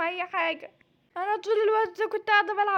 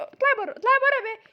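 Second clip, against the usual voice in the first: fast, louder, higher order; angry dismissal; 4 semitones higher, 5 dB louder, faster than usual. Acted. Doctor kicks Malak out, fast angry order. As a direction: throw her out with a quick raised voice. Throwing the trainee out.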